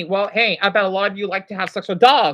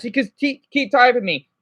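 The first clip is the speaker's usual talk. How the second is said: ordinary talk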